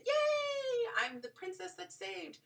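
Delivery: mock princess. Mocking the rescued-princess moment, high gushing. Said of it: "mock princess".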